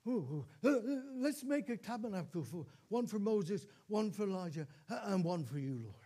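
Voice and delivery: flustered, stammering outburst as Peter; blurting out. Acted. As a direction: blurt it out, stumbling over repeated ohs. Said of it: "and he blurts out".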